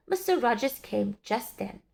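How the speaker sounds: ordinary delivery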